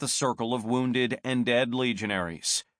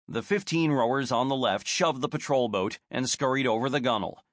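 usual speaking voice

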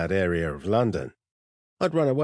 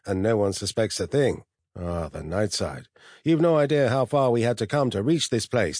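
higher-pitched, scornful superior intelligence; haughty, disdainful computer; 5 semitones higher, 3 dB louder than usual. Acted. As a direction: lecture a lesser species, swelling into self-admiring rapture. Narrator voices the disdainful steel sphere.

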